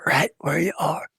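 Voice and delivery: ordinary delivery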